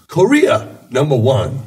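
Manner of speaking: high-pitched boast on "Korea"; mock patriotic driver; imitating proud driver. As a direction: proclaim "Korea" high, then "number one". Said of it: "He's like".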